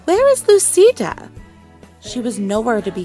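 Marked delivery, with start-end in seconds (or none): 0.0-1.3 high, loud searching call. A narrator's reading. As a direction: call out for a missing child, pitched way up. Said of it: "Senora Gomez called on Christmas Eve".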